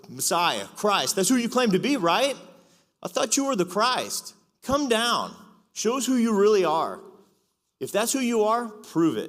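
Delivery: high, loud jeering at the man on the cross; mocking taunt. Mockers at the cross taunting Jesus, high loud jeer. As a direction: sneer it up at him, daring him to come down. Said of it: "And they're like".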